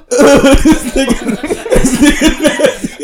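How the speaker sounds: very high, slow, laughing squeal; laughing mockery. Mocking the roommate's outfit, high laughing squeal. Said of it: "I was like".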